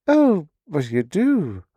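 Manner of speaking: slow, sweet and high. Acted. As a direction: say it with a sweet smile. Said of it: "She smiled sweetly".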